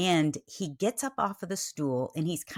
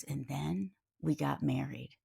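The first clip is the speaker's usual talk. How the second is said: imitating his hushed, lowered voice; soft and subdued, as a grave reveal; low and quiet; 3 semitones lower, 6 dB quieter than usual. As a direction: drop the volume for the retold confession. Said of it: "his voice goes low and quiet".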